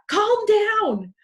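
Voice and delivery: shouted high and loud; mock yell. Shown as a demonstration. Parent yelling at a stressed kid, mock shout. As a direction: bark it like a frazzled parent.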